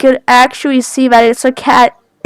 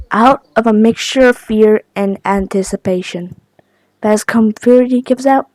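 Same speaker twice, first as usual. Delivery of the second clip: ordinary delivery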